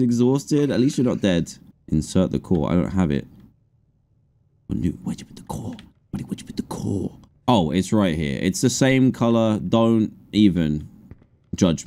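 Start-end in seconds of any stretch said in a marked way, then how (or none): 4.7-7.2 soft unvoiced whisper, much softer; hushed, asking the little buddy quietly. Real, not acted. Whispering to Newt about the missing core.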